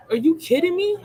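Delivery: high, outraged disbelief; a cry of are you kidding me; incredulous outburst. Real, not acted. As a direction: throw it up in real disbelief. Outraged rant about Seth.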